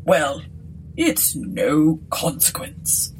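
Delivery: slow, dismissive gentleman captain; leisurely, unbothered composure; cool. A narrator's reading. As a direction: brush it off without a care. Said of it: "he said coolly".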